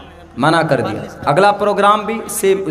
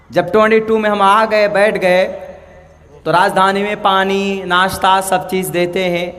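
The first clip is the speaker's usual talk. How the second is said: ordinary talk, nothing marked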